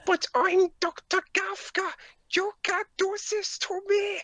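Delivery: hammed-up German accent. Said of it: "for your German accent".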